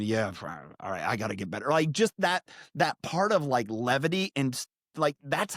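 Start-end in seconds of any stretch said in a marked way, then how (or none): 0.0-1.7 lower, softer, resigned owning-up; mock a mildly chastened reply; sheepish admission. Dad answering a neighbour who heard him yelling, mildly sheepish.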